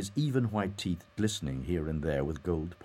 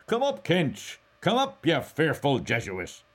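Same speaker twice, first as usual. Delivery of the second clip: loud, high shout to someone below; coarse call; 11 semitones higher, 7 dB louder than usual. A narrator's reading. Mulligan calls Stephen up the winding stairs, coarse shout. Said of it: "called up coarsely".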